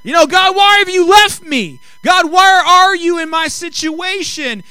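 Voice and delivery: high, loud mock complaint; griping to God. Believer in trouble griping to God, loud high-pitched protest. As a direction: wail it as an exaggerated gripe at God. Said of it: "We start griping".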